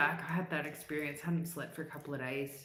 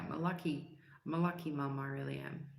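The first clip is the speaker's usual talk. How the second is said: ordinary delivery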